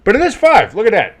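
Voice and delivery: high, loud burst of triumph; excited crowing. Real, not acted. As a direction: crow that you called it right. Crowing over his correct call.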